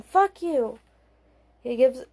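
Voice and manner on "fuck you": sharp high scream; a sudden furious yell. Acted. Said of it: "Simone is screaming".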